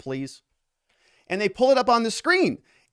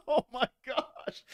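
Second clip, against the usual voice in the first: slow, laughing gasp of disbelief; 7 semitones higher, 7 dB quieter, slower than usual. Real, not acted. Host laughs at the witness's non-answer. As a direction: let the laugh carry the words.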